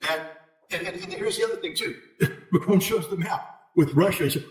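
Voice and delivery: ordinary talk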